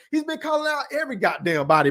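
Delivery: normal talking voice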